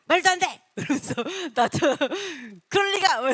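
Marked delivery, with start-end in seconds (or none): 0.1-0.6 loud, high outburst of disbelief; acted indignant objection; arguing. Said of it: "다투래요". 2.7-3.3 high, loud cry of disbelief; mock quarrelling, raised voice; arguing. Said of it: "이러면서 다투기도 하래요".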